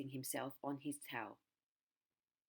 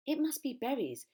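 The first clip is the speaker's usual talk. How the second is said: high and louder, eager guess; eager high sing-song; 10 semitones higher, 8 dB louder than usual. Acted. Bird eagerly guesses at the crocodile's secret, high and bright. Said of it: "sang the roly-poly bird".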